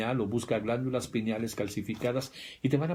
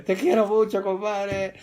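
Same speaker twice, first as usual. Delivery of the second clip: put-on much louder, higher, slurring drunken affection; drunk buddy; 8 semitones higher, 7 dB louder, slower than usual. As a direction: gush like a drunk friend. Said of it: "se emborracha y sale". (borracho cariñoso, muy fuerte y agudo)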